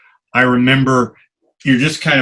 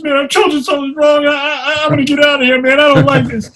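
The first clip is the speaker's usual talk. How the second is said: frantic babble, much higher and fast; Willie Mays babbling; 4 dB louder, faster than usual. Retold or imitated. Ballplayer spooked by the earthquake, bolting from the field, frantic high babble. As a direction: spill it out in a panicked rush, voice way up. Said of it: "Willie was just babbling".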